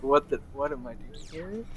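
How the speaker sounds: very quiet, bewildered mutter; hushed aside to yourself; muttering to himself. Acted. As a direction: mumble it under your breath. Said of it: "he just mutters to himself in Sylvan".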